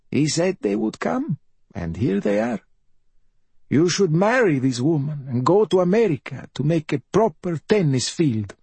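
high, self-pitying bedridden mother; high-pitched raspy invalid voice; rasping mother. A narrator's reading. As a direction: rasp it high, a mother playing at dying.

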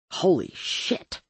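slow, voiceless gasp of shock; stunned. A narrator's reading. Narrator sees Claire upstairs, stunned whisper.

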